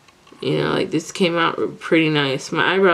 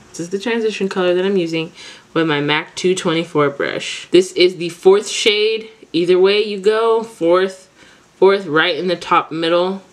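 normal talking voice

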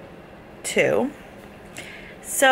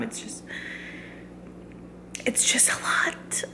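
overwhelmed whisper; 10 dB quieter than usual. Real, not acted. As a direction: let the emotion catch the words. Mum overwhelmed before the birth.

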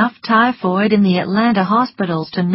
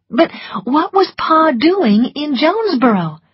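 alarmed question; 9 semitones higher, 3 dB louder than usual. A narrator's reading. Alarmed question about her father.

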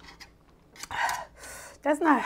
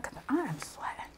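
whisper; 4 semitones higher, 17 dB quieter than usual. Real, not acted. Sweating from the spice.

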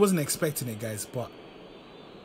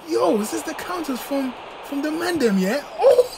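excited; 12 semitones higher, 5 dB louder than usual. Real, not acted. Excited reaction to conceded counter.

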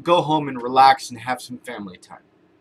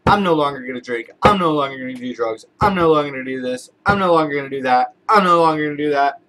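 fast, louder run of repeated resolutions; rattled-off vows; 4 dB louder, faster than usual. Retold or imitated. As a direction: fire off each vow quickly with the same emphatic tune. Listing resolutions people rattle off.

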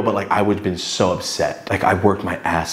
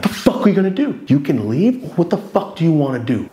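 higher-pitched retold confrontation; angry challenge; 6 semitones higher than usual. Man telling rival to leave or act, angry challenge. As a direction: challenge him, pitch jumping up. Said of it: "so fucking violently mad".

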